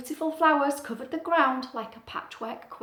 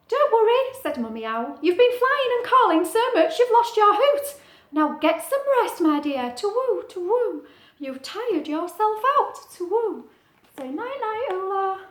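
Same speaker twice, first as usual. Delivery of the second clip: Mummy Owl; 9 semitones higher, 6 dB louder than usual. Acted. Mummy Owl soothes Ula.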